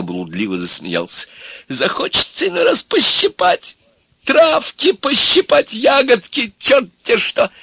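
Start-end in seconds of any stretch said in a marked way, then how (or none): 1.7-7.5 very high, loud, gleeful voice; giddy old man. Acted. Old man confesses to wanting berries, giddily high-pitched. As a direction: let the longing run away with you.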